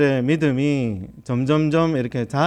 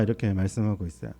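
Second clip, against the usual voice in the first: ordinary talk, nothing marked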